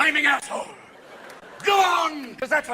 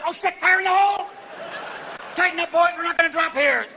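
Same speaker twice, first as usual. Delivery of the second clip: shouting soldier; 5 semitones higher than usual. Retold or imitated. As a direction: yell like under fire. Soldier commands.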